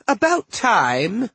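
high, slow, exasperated cry; impatient girl voice. A narrator's reading. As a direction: snap it like an annoyed sister. Sadie's voice from the pot.

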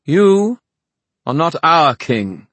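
high, loud, slow declaration; ringing, deliberate refusal; proud defiance. A narrator's reading. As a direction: defy him proudly, word by word. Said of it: "She faced Oban proudly".

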